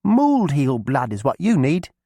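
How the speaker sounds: put-on high, loud girl's call; young girl voice. A narrator's reading. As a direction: call out eagerly to old cousin. Alice persuades the witch.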